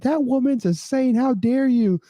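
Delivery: high, slow indignant mocking voice; mock outraged yell. Drunk guys yelling about the mother, high mocking outrage. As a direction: play the bros as shrill and offended. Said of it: "they were, like, screaming".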